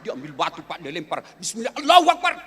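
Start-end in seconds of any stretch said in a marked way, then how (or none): 1.4-2.5 high, loud invocation while throwing; loud, effortful acted cry; stone-thrower's cry. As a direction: hurl the words with the stone. Acted pilgrim hurling a stone.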